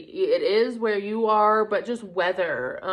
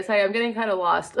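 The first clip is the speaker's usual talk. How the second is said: normal talking voice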